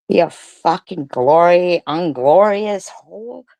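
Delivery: ordinary talk, nothing marked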